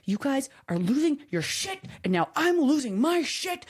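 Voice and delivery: high-pitched mock meltdown; losing it. Mom to whining kids in the car, mock losing it. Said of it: "it's like I'm aware that I've lost my shit".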